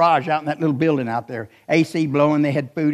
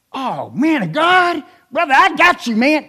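"Oh, man of God" drawn out slow, higher and louder; 10 semitones higher, 7 dB louder, slower than usual. Retold or imitated. Preacher imitating the rapper's man greeting him. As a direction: ham up the entourage guy's greeting. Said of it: "that's how they talk".

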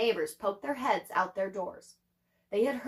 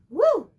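real relieved whoop; 9 semitones higher, 10 dB louder than usual. Real, not acted. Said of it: "exclaims after interruption".

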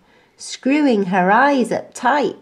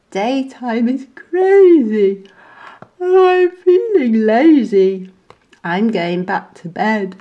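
sleepy monkey; 3 semitones higher, 6 dB louder than usual. A narrator's reading. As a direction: declare bedtime wearily.

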